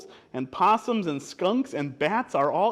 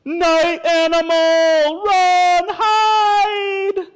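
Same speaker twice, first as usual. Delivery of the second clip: high, slow, drawn-out yell; loud hammed-up panic; terrified warning shout; 13 semitones higher, 10 dB louder, much slower than usual. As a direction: scream it as a storybook alarm. Animals scream a warning.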